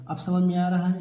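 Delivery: normal talking voice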